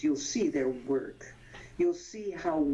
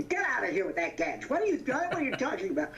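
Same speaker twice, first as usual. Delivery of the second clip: playful exasperated retort, rising high and fast with a laugh; imitating a friend's mock fury, half laughing; hammed-up annoyance breaking into laughter; mock mad outburst; 7 semitones higher, faster than usual. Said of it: "he would get so mad at me".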